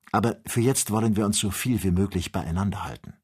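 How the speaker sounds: plain reading voice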